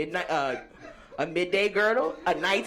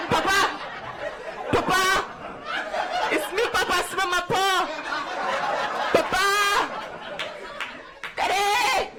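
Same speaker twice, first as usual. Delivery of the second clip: put-on frightened child pleading; 10 semitones higher than usual. Child on the ground begging startled dad, frightened pleading. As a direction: plead up high like a scared kid.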